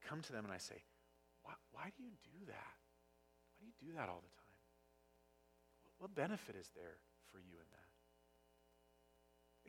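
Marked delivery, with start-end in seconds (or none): 1.4-9.7 put-on soft, calm question to the kids; gentle parent asking kids kindly. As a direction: ask it tenderly, like a patient parent. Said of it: "feels very different".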